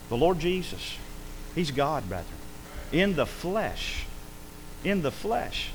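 soft, slow reverent hush; hushed awe. Real, not acted. Hushed awe after loud preaching.

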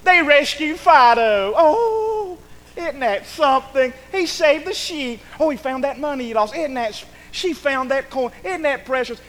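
high, drawn-out gushing; hammed-up delight, mocking the easily thrilled; mock excitement. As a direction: gush over it in an exaggerated high voice. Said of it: "they get all excited".

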